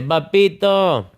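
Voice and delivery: a hint of a sleepy boy calling; drawn-out papito; child calling papito. Son calling him again.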